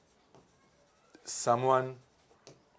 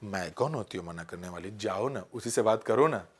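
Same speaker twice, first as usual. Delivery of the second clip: a hint of coy, lower and much softer tease; coy heroine; 6 semitones lower, 5 dB quieter than usual. Heroine coyly telling the hero to go, mildly shy and teasing. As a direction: lightly play the shy, flirty heroine. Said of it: "Sharma के, इतरा के, प्यार से बोलती है".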